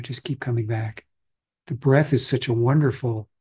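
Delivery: ordinary talk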